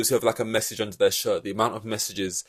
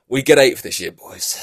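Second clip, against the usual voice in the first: louder, rallying cheer to the team; hyped boss; 4 semitones higher, 7 dB louder than usual. Retold or imitated. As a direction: pump up the boys over eighth place. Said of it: "And James is like".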